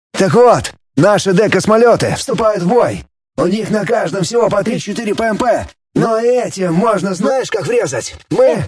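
excited over a radio link; sci fi communicator. A narrator's reading. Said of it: "из коммуникатора".

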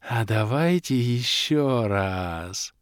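slow, lightly voiced hopeful elf. A narrator's reading. Bald elf asks the hero for another flight, hopeful. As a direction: drag it out, hoping for another go. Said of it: "предложил лысый эльф, с надеждой".

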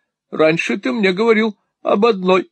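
high, pointed objection; challenging wife. A narrator's reading. Wife challenging husband's boast, high and pointed.

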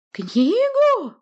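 very high, loud screech; piercing shriek. A narrator's reading. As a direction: screech it so ears hurt. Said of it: "пронзительный и визжащий".

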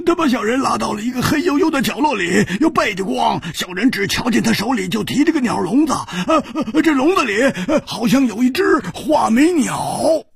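high, strained, whining thug voice; a scared big man begging for mercy; pained lackey. A narrator's reading. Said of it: "这个大汉说".

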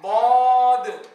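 ضاد drawn out long and heavy, slow and sung; teacher chanting ضاد slowly for students; model letter name. Shown as a demonstration. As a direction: hold ضاد slowly with full emphatic weight. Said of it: "كيف ننطقه؟".

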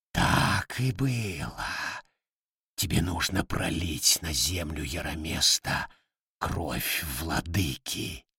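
low, slow otherworldly voice; a dark power speaking through a hen; deep demon voice. Acted.

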